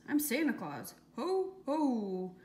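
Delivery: loud, hearty storybook Santa; Santa act. A narrator's reading. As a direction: boom out a hammed-up Santa ho-ho. Louis pretends to be Santa.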